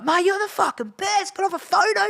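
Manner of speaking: high, over-the-top fan voice; mock gushing punter; gushing drunk fan. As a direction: ham up a drunk fan begging for a photo. Retold fan turned gushing.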